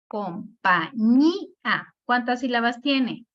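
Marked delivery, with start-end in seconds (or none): 0.1-1.9 "Compañía" slowly divided as com-pa-ñí-a; syllable by syllable. Shown as a demonstration. Teacher answering how the word divides, "Compañía" syllable by syllable. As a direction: separate each syllable of "Compañía" with small gaps. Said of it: "cuál sería la división".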